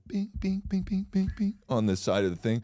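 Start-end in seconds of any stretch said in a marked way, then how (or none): 0.1-1.5 very high, light bings; imitated spoon banging. Mimicking the worker banging the spoon, high. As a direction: tap out the spoon sound. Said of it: "and then they go".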